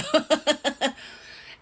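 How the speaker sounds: loud laugh at her own story; loud high laugh. Real, not acted.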